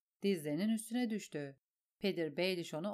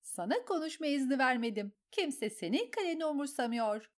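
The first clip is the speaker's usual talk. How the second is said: furious; 6 semitones higher than usual. A narrator's reading. Lysa rages at Sansa, furious. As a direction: tear into the girl.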